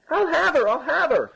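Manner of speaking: very high-pitched insistent cry; frantic bawling. A narrator's reading. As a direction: cry out desperately from the sack. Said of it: "louder than ever".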